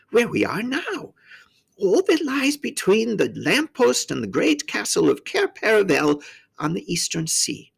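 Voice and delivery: high-pitched faun character voice; courteous faun. A narrator's reading. Faun describing his land to a newcomer, high and courteous.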